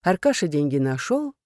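ordinary delivery, nothing marked

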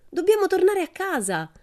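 high, loud call. Acted. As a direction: shout it across the wood. Pippi calling the monkey.

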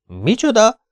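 very high, loud shocked question; horrified. A narrator's reading. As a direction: squeak it out, suddenly aghast. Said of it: "rémült meg Ahmed". (elszörnyedt, magasba szökő kérdés)

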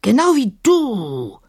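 slow, high mocking djinn voice; drawn-out menace from the djinn; slow djinn taunt. A narrator's reading. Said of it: "entschied Sahim".

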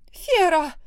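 high piercing shriek of a name. A narrator's reading. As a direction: shriek for help. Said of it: "заверещала Лиса".

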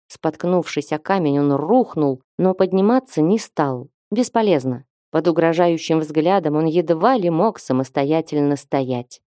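plain reading voice

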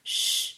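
sharp hissed "shh"; long urgent hush; hushing shush. A narrator's reading. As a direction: shush them to silence. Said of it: "fece la moglie del locandiere".

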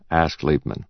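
ordinary delivery, nothing marked

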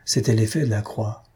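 ordinary delivery

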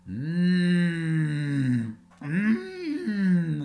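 high loud teasing hum; mocking hum. A narrator's reading. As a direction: taunt with a closed-mouth hum. Puppet sticks out its tongue.

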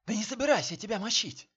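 high, loud, fast denial; loud protest. A narrator's reading. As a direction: shout the denial out in a rush. Man pinned, protesting loudly.

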